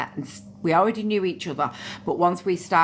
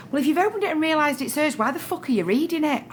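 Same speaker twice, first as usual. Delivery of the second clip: indignant; 10 semitones higher, faster than usual. Retold or imitated. Retelling row with mother-in-law.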